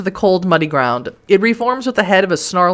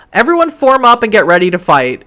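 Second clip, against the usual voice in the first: calling out; 5 semitones higher, 5 dB louder than usual. Acted. Calling the pack to fight.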